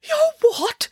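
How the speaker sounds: very high, incredulous sputter; sputtering disbelief. A narrator's reading. Doctor hears she is branching out, sputtering. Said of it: "sputtered the doctor".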